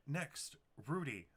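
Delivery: ordinary talk, nothing marked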